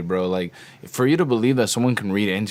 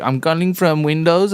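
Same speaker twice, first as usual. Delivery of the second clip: mock scam caller; 7 semitones higher, 3 dB louder than usual. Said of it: "he's like".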